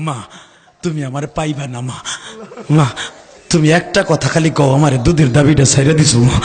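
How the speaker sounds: low, broken plea to mother; acted sobbing son, pitch sunk low; tearful farewell. Dying son's farewell to mother.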